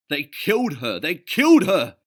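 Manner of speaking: pirate first mate screaming in rage; enraged first mate bellowing at the sailors; shouting. A narrator's reading. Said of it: "he shouted".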